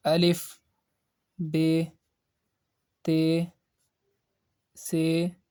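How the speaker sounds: Urdu letter names, Be, Te, Se with long "ay" vowels; Urdu accent. Shown as a demonstration. Teacher shows pronunciation to avoid, Urdu accent. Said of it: "Urdu accent, like".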